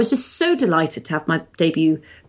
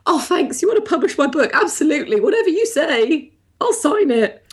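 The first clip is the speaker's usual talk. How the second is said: higher, gushing eager voice; eager younger self; 9 semitones higher than usual. Retold or imitated. Author mimics her younger self accepting the publishing deal, gushing. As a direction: gush like a grateful new author. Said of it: "And I just went".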